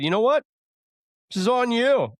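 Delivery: high, loud mock-indignant scolding; hammed-up high scolding. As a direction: scold her in exaggerated huff. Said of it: "I said".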